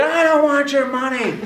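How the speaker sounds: high, loud refusal; exasperated retort. Retold or imitated. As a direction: re-enact an irritated shout back. Teller yelling back, acted.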